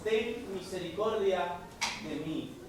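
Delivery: quiet, drawn-out plea for mercy; hushed plea. Acted. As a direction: pray it under the breath, slowly. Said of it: "Clamar aún más fuerte".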